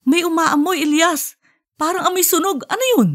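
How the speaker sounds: high, rising alarmed wife's voice; sniffing out danger, voice climbing high. Acted. Wife smells burning.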